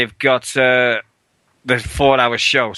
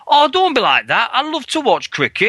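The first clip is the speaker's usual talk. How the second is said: imitating a train chav; 10 semitones higher than usual. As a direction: ham up the cricket fan on the train. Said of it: "he goes".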